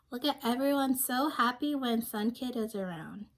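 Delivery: ordinary delivery, nothing marked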